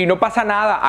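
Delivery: normal talking voice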